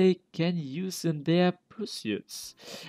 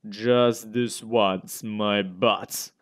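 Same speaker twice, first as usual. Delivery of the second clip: slow, lower sarcastic repeat; mocking echo; 6 semitones lower, much slower than usual. Visitor echoing the doctor's sudden favour, slow and mocking. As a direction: drag out the quoted words in scorn.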